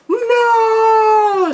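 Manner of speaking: a hammed-up cry of despair; over-the-top shout as the partner dies; mock anguished shout. Reacting to partner's death.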